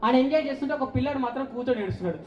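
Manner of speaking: normal talking voice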